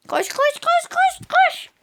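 very high, slow squeal; high squeaky urging. Real, not acted. Urging the character on.

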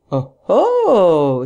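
loud, drawn-out "oho" that swoops; knowing exclamation. A narrator's reading. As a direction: exclaim it with theatrical surprise. Hero exclaims at detective's correction.

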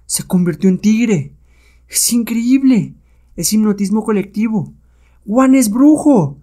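high, drawn-out amazement; astonished. Acted. Onlookers see the hero seem to become a tiger, astonished. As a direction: marvel slowly in a high voice.

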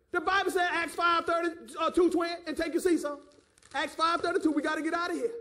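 impassioned sermon urging; fervent preaching. Real, not acted. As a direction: drive the point home to the congregation. Preaches at full force.

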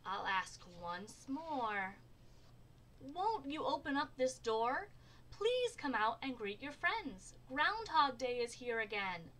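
mild storybook pleading. A narrator's reading. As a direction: plead gently, stretching the first words. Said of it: "The mayor pleaded".

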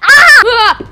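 high, loud startled screams. Acted. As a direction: shriek in fright. Scared by a loud blam.

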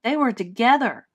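put-on loud, accusing American accent. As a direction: point the finger, American girl. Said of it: "she said in an American accent".

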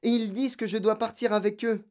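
put-on high, urgent child's explanation; distressed. A narrator's reading. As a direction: spill the news in dismay. Distressed girl explains to teacher.

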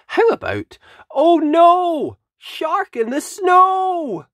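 high, slow, dramatic title call; excited title. Acted. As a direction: announce the title like a big reveal. Announcing the book title.

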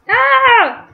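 short high loud scream; startled scream. Real, not acted. Gamer caught off guard mid-match, startled scream. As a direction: yelp out loud in alarm. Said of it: "Sorry for the screaming".

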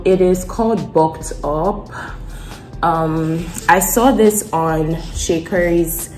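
ordinary delivery

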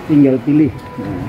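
usual speaking voice

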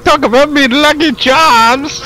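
put-on high squeaky leprechaun Irish accent; cheeky leprechaun in an Irish accent. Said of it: "That one close".

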